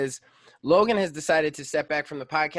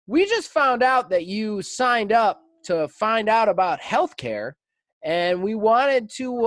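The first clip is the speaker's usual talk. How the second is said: slow, higher, canned sales-call voice; robocall recording; 6 semitones higher, 4 dB louder, slower than usual. Retold or imitated. Mimicking the robocall to his friend, canned and slow. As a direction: imitate a prerecorded insurance pitch. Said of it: "Oh, this is a recording".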